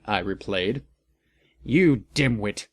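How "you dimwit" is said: usual speaking voice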